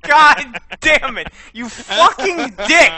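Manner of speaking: loud slow furious cursing; angry shout. Real, not acted. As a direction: yell it out, half laughing. Reaction to scare.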